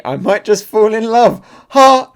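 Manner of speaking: high, loud mock swoon; swooning admirer. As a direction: gush like a smitten fan, leaping up. Acting swooning admirers in manga.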